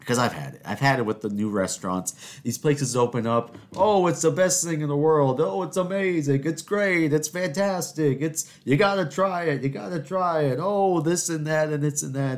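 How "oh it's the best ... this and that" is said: mock gushing hype. Mocking hyped new restaurant fans.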